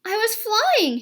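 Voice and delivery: high squeaky little voice. A narrator's reading. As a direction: squeak it out proudly. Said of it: "he squeaked".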